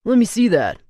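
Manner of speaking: snatch it with your voice; demanding. A narrator's reading. Alex demanding, loud.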